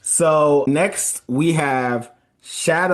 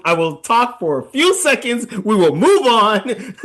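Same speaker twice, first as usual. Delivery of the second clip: laughing; 5 semitones higher, 4 dB louder than usual. Real, not acted. Laughing promising brevity.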